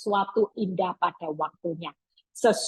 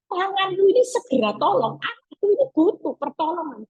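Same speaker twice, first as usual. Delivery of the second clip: Jairus pleading; 7 semitones higher than usual. Retold or imitated. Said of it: "Yairus yang sudah bilang gini".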